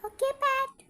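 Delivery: put-on a second child chirping okay; other child's voice.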